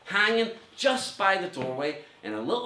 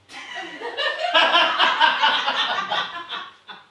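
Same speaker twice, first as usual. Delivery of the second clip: laughing; 6 dB louder than usual. Real, not acted. Laughing at own joke.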